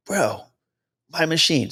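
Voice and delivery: lightly acted yell of a pilot; mock yelling. Pilot grabbing the controls from the other pilot, mild mock yelling. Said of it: "other pilot starts yelling at him".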